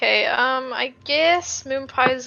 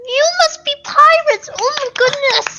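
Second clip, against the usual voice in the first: much higher, gushing with excitement; excited squeal; 10 semitones higher than usual. Acted. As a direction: gush like a starstruck fan. Character thrilled at pirates.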